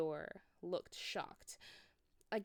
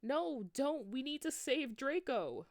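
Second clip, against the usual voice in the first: higher, louder desperate plea; begging; 8 semitones higher, 5 dB louder than usual. Acted. Students pleading with Dumbledore, higher desperate begging. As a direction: beg him, urgently. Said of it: "we begged".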